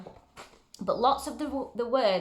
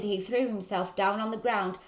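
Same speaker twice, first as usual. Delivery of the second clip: usual speaking voice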